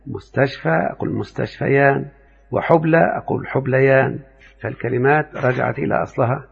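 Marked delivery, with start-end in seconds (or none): none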